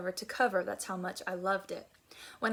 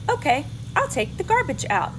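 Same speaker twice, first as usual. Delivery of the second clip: put-on a slightly higher, louder girl's voice, giving in; Sarah's voice; 6 semitones higher, 10 dB louder than usual. Sarah finally agreeing to take the garbage out, a touch of reluctant girl. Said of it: "Sarah Cynthia Stout said".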